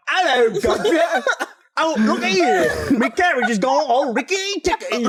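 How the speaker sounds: lightly hammed-up posh English; bad American's idea of a Brit; a hint of stage British; mock British accent. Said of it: "Well, I'm like".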